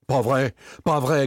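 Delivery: high, tense protest; growled denial. A narrator's reading. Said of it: "gronda Victor".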